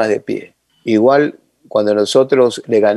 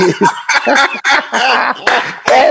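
high laughing cackle; 12 semitones higher than usual. Retold or imitated. Coach laughs at his joke.

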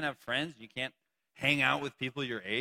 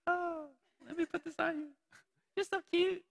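much higher, breathy-free sweet girlfriend impression; 14 semitones higher, 3 dB quieter than usual. Pastor jokes about a girlfriend crowning you, high cutesy voice. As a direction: gush it like a doting girlfriend. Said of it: "That would be weird if your girl".

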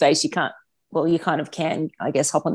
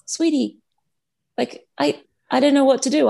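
exasperated mum; 8 semitones higher than usual. Retold or imitated. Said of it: "I'm like".

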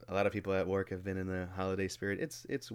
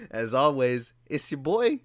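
higher, louder podcast host intro; show intro voice; 5 semitones higher, 7 dB louder than usual. Pretend. Co-host introducing himself to the people, hyped intro voice. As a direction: announce yourself big, pitched up. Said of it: "do the voice for the entire thing".

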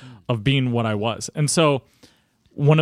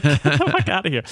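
laughing dismissal; 2 semitones higher than usual. Retold or imitated. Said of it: "And I was like".

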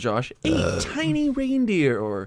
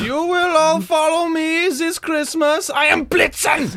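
high, drawn-out German commander; hammed-up German accent; 12 semitones higher than usual. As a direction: declaim like a German tyrant, high and slow. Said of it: "Sounds German".

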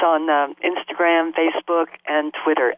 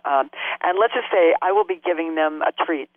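usual speaking voice

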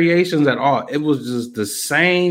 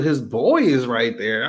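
ordinary talk

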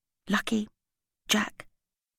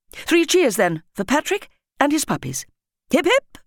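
much higher and louder, leading a cheer; 8 semitones higher, 5 dB louder than usual. A narrator's reading. Headteacher leading the whole school in cheers, loud. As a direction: call the cheer out to the hall. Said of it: "three loud cheers".

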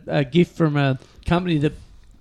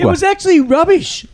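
much higher, teasing; high mocking voice; 11 semitones higher than usual. Mocking the hard rubbish haul, high. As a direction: squeak it out like a mock lament.

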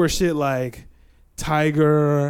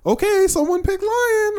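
mock teacher. Boy mimics teacher calling out the zoo-animal picks, high singsong. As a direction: chirp it like a prim first-grade teacher. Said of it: "the teacher is like".

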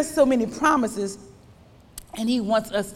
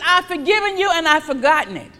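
preached quote; 7 semitones higher, 7 dB louder than usual. Acted. Preaching, God's words.

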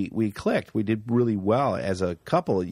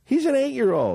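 high-pitched, incredulous exclamation. Real, not acted. Actor stunned by driver's childhood commute, incredulous. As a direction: exclaim it in amused disbelief.

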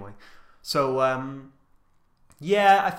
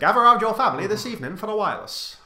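put-on old-timey radio announcer; 7 semitones higher, 9 dB louder, faster than usual. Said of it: "in a very old-timey voice".

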